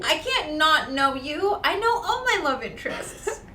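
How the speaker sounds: high, loud and laughing; excited. Real, not acted. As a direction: gush it out. Excited complaint.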